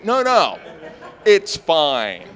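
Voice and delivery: high, loud shout; shouted, frantic reassurance; panicked call-out. Acted. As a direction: call out urgently to defuse things. Calls out the "code word" in panic.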